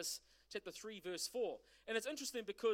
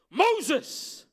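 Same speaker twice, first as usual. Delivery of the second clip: Moses called out much louder and higher, falling at the end; God calling Moses again from the bush, more insistent; 5 semitones higher, 16 dB louder than usual. Acted. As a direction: call Moses a second time, stronger. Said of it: "He says".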